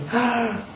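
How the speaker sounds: quick startled intake of breath; sharp gasp. Shown as a demonstration. Preacher showing how desolation takes breath away, sharp gasp. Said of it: "Like that".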